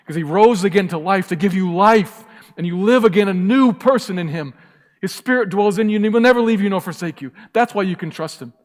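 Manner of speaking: loud, high, soaring preacher's cry; preaching at full force. Acted. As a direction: lift it up and proclaim it to the whole church.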